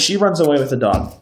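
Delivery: usual speaking voice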